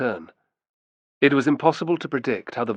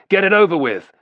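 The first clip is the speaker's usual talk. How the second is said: loud, higher, hostile call; shouted; 9 semitones higher, 7 dB louder than usual. A narrator's reading. A voice from the back urges killing the pair, shouted. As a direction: call it out over the crowd. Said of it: "shouted a voice from the back".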